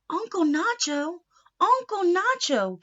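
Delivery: calling at door. Acted. Niece calling her uncle through the door. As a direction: call him from outside the door. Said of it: "came a voice at the door".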